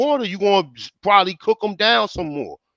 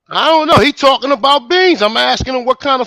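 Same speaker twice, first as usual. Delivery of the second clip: raised and louder, genuinely worked up; heated outburst; 6 semitones higher, 5 dB louder than usual. Real, not acted. Chef losing patience over a question about beans, angry outburst. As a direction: snap back hard over the question.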